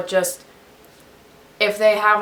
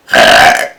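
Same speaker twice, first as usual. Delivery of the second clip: burp; 14 dB louder than usual. Real, not acted. Burp then laugh.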